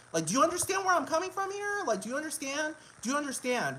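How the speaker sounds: very high-pitched, incredulous pleading; exasperated appeal. Real, not acted. Ranter begging the listener to get the point, exasperated squeal.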